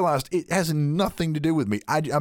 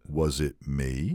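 much lower, softer and slow; small timid question; 10 semitones lower, 6 dB quieter, slower than usual. Retold or imitated. Someone guiltily asking an angry person if it was them. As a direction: shrink into a guilty little question. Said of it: "you're like".

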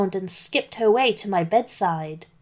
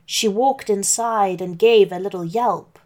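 plain reading voice

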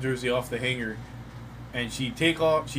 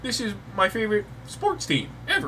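defensive excitement; 8 semitones higher than usual. Retold or imitated. Husband telling wife the team hits different, high and eager. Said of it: "I'm like".

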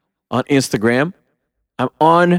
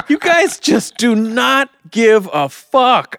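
higher, slowed mock-appalled scolding; exasperated disbelief; 6 semitones higher, slower than usual. Fan scolding the toy company, exasperated. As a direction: scold the company, laughing through it. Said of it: "I'm like".

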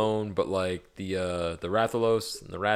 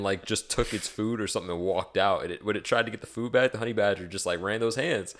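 ordinary delivery

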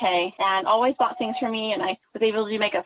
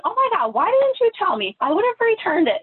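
high, louder, fretful service voice; customer service mode; 10 semitones higher, 4 dB louder than usual. Retold or imitated. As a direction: act out an over-apologetic saleswoman, pitched way up. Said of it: "like, customer service mode".

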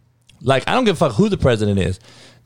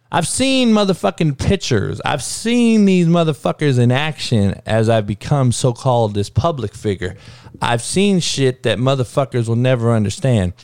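ordinary talk

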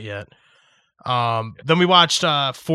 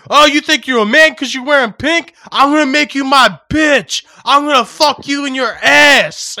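higher, louder, sneering tough-guy voice; mock inmate taunt; 11 semitones higher, 8 dB louder than usual. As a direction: taunt like a hammed-up jail bully. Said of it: "and he's like".

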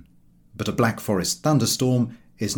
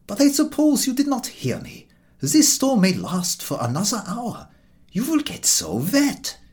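put-on higher, gentle, concerned old man; kindly old gentleman; 11 semitones higher than usual. The old gentleman worries to the walkers about the storm, high and gentle. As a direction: fuss kindly over the wet strangers. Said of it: "He was a kindly old gentleman".

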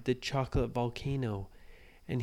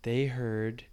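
usual speaking voice

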